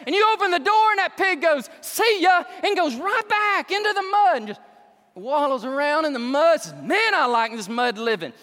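loud, high, animated preaching; full-force preaching. Acted. Preacher mocking false teachers to the congregation, loud lively preaching. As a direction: paint the pig story with fire. Preaching the pig story loudly.

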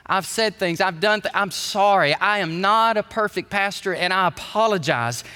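normal talking voice

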